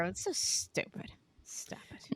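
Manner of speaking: voiceless, dismissive whisper; whispered scorn. Real, not acted. Podcast host dismissing a reality show's drama, whispered. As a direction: whisper it under your breath.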